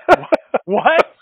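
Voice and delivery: high, loud, laughing disbelief; can't believe what you just heard; a laughing squeak of total disbelief; stunned disbelief. Retold or imitated. Retold disbelief at MPAA approval.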